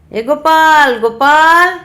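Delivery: loud, high call up the stairs. Acted. Uncle calling his nephew down from upstairs, loud call. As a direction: holler the name to someone far off. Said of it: "তো ডাকছে".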